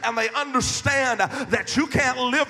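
loud, rising pulpit preaching; fiery sermon voice, slowing on the last lines; full-force preaching. Acted. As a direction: preach it to the back row.

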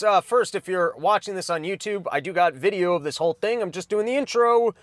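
ordinary talk

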